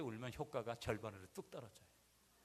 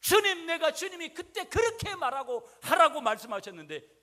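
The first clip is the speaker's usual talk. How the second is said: pleading repentance prayer; 16 dB louder than usual. Retold or imitated. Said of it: "회개할 거 있으면".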